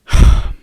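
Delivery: loud acted sigh; heavy sigh before scolding a friend; exasperated sigh. Teller sighs before scolding friend.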